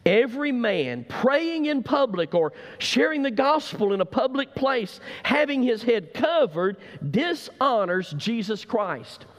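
preaching at full force, slowing on the last words. Acted. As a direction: proclaim it like a revival preacher.

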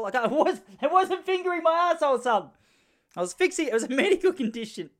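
high, loud flustered protest; indignant denial. Acted. Acting out flustered denial to son.

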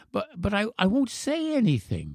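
put-on high, soft, stammering child; insistent boy. A narrator's reading. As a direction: plead your case as a little boy. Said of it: "the boy insisted".

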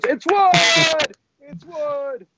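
very high, drawn-out celebratory yell; hammed-up screamed announcement; mock crowd shout. Everybody exclaims in mock triumph.

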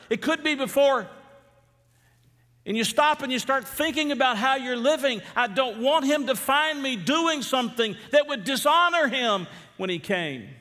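high, loud preaching that climbs as it goes; a pastor's fervent warning that Jesus could come today; full-force preaching. Acted. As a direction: preach it with rising urgency.

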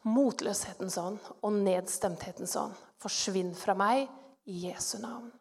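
low, soft command so as not to wake a sleeper; hushed, near-whisper. Retold or imitated. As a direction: say it under your breath, cautiously. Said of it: "Så sier jeg litt sånn hviskende, for ikke å vekke han". (lavt og forsiktig, nesten hviskende)

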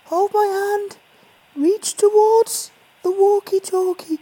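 very high, slow coaxing voice; gentle soothing voice. Acted. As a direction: soothe it like a nervous animal. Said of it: "It's a very gentle voice. It's a very soothing voice".

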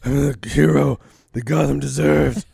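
low, slow put-on hero rasp; Batman. Batman's closing monologue, mocked low slow voice. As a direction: intone it in a mock-grave, forced low voice. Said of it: "monologue at the end of the movie with that voice".

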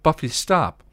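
high, loud urgent plea; alarmed cry. A narrator's reading. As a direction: shout to make her stop. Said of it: "Willow cried".

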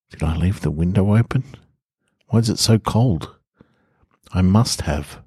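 usual speaking voice

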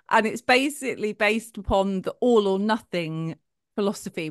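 normal talking voice

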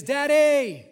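high, loud drawn-out call; imitated child's yell. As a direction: yell like a little girl calling across a room. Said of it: "yelling across all her friends".

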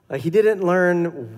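ordinary delivery

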